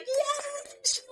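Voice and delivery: very high, thin squealed yes; excited reaction. Retold or imitated. Host re-enacting her reaction to the scene, excited squeal. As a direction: squeal it small and high, thrilled. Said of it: "I was just like".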